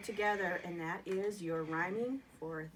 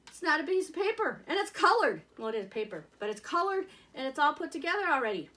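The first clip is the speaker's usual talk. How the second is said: 1 excited; 6 semitones higher, 6 dB louder, faster than usual. Real, not acted. Excited about colored book.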